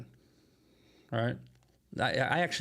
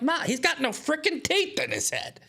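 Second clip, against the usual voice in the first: much higher, exasperated retelling of pleading with his mom; exasperated plea. Retold or imitated. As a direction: throw up your hands at mom, voice shooting up high. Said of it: "I'm like".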